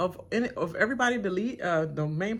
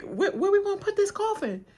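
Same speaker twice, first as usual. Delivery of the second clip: much higher, fussing voice; high-pitched quote voice; 5 dB louder than usual. Retold or imitated. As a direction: squeak it out, flustered. Ron voiced.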